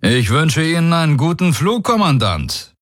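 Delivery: high, lifted commander voice; confident warlord. Acted. Daraios assuring the commander of his oath, high and confident. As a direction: assert it with cool certainty.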